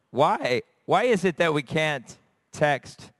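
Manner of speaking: ordinary talk, nothing marked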